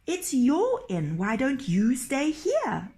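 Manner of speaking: high, slow, baffled question; puzzled. Acted. Nils questions the innkeeper, high and baffled. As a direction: point out the obvious in disbelief.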